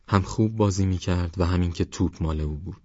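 ordinary delivery, nothing marked

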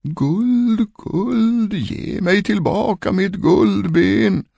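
high, long-drawn wail on one note; ghostly calling voice. A narrator's reading. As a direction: wail it from beyond the grave. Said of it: "rösten ropade igen". (klagande, utdraget spökrop)

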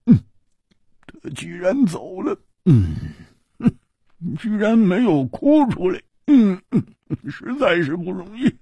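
高而亮的粗豪汉子嗓音，感慨不已; 又佩服又唏嘘地嘟囔; 胡铁花的声音. Acted. 胡铁花的角色声音. (高亢的胡铁花感叹)

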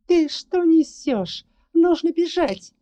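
high, loud hysterical cry; hysterical exclaim. Acted. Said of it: "истерически засмеялась и воскликнула".

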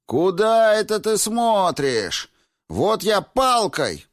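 high, loud, drawn-out yell; scolding shout. A narrator's reading. Women in the ward shouting at man hobbling past, high scolding yell. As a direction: holler a threat at a peeping passer-by. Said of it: "кричали на мужчину".

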